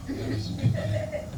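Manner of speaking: "Got me some good credit" whispered, breathy and hushed. Shown as a demonstration. Comic telling the crowd a secret, in a whisper. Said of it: "repeated line, plain then whispered".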